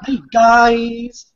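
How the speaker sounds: high, loud camp vlogger greeting; James. Retold or imitated. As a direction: stretch out a high-pitched mimicked hello. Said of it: "Right, I'll do James now".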